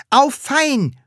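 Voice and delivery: high, gleeful cry; delighted. Acted. Pupil threatened with cane, delighted. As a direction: welcome it with cheeky glee. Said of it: "freute sich das Sams".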